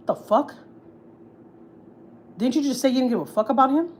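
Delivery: indignant disbelief. Real, not acted. Daughter-in-law challenges absent mother-in-law's hypocrisy, indignant disbelief.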